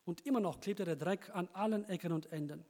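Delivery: normal talking voice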